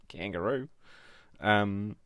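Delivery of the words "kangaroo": mock Australian accent, higher, rising at the end. Crafter offering Australian viewers her version, amateur Australian accent. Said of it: "really all I can say".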